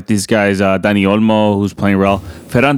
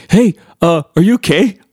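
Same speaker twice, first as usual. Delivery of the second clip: high, raised voice calling down a staircase; calling from upstairs; 10 semitones higher than usual. Retold or imitated. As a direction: call up the stairs to check on him. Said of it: "my wife's, like, yelling down from upstairs".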